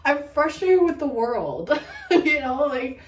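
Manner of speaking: laughing through the words, voice jumping up high. Real, not acted. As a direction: shake off the anger with a real laugh. Laughing at her own rant.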